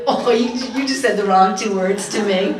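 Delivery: hammed-up threat to a mouthy daughter; mock stern anger. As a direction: put the defiant teen in her place. Mother's angry retort quoted.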